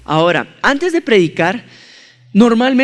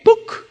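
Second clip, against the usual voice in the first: door pop sound; 12 semitones higher than usual. Retold or imitated. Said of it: "y la puerta hace".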